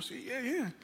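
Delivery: respuesta bajita y aguda, con timidez; un poco asustadito. Retold or imitated. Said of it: "un poquito asustadito". (sí nervioso y suave, imitado)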